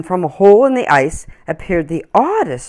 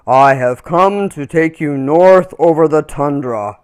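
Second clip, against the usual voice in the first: louder, lightly voiced calm bear; deep soothing bear; 7 dB louder than usual. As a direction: speak like a calm big bear. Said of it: "he said in a deep, soothing voice".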